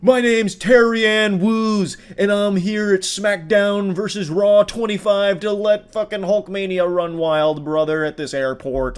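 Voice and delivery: mock wrestling-promo shout; wrestling entrance. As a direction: bellow it like a WWE ring entrance. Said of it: "announces herself like it's a W W E entrance".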